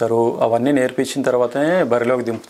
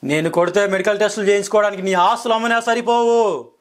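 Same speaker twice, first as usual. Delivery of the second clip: angry dialogue; 8 semitones higher than usual. Shown as a demonstration. Actor delivers Simha dialogue in anger for the interviewer. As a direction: bellow it like a mass hero threatening a villain. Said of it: "కోపంగా చెప్తాను dialogue".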